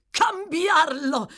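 outraged echo, drawn out; exasperated cry. A narrator's reading. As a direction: stretch the word out at the end of his rope. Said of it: "esasperato oltre ogni limite".

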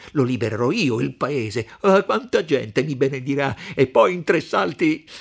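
loud, heated triumphant ranting; fierce exultation. Acted. Renzo imagining freeing the village, loud and fierce. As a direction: gloat loudly over the deed to come.

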